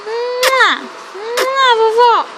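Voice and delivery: put-on high, cooing baby kisses; baby kisses for grandma. Sending grandma a kiss for baby. (beijinhos em voz fininha de bebê)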